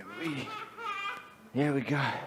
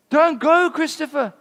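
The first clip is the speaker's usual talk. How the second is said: high, loud call after him; shouted plea; 11 semitones higher, 8 dB louder than usual. Acted. The dark wood shouting after Christopher, high and loud. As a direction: cry out begging him to stay. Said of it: "shouted out after him".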